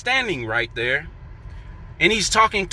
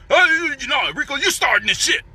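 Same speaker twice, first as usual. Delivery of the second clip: higher, mock yell of a coworker; imitating yelling; 8 semitones higher, faster than usual. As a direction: ham up his angry shouting, faster on the second line. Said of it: "He starts to yelling at me".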